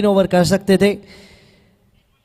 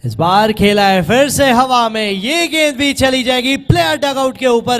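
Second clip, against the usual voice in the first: higher, louder rapid play-by-play; excited cricket commentary; 7 semitones higher, 4 dB louder than usual. Acted. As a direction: ride the rush of the boundaries. Back-to-back boundaries called live.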